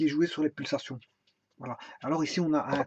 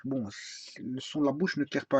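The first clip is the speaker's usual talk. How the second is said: normal talking voice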